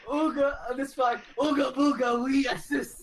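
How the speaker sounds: mock a dim brute's broken speech; caveman voice. GM mocking the party's message in caveman voice. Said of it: "I just found it hilarious".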